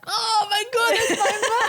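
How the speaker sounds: high, loud, drawn-out mock squeal; imitating excited kids. Kids spotting mum on a magazine cover, imitated excited squeal. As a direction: squeal it like an amazed child, laughing.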